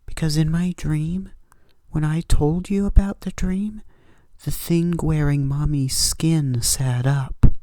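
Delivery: put-on high, eerie child voice; little daughter. Daughter telling her father about the thing in bed, high child voice.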